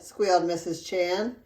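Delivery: ordinary delivery, nothing marked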